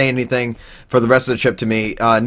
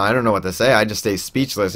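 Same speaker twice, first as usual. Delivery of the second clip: ordinary talk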